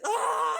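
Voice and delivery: loud, high, breathy yell of pain; pained shout. Acted. Villain yelling as pepper spray burns his face. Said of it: "pepper spray on his face".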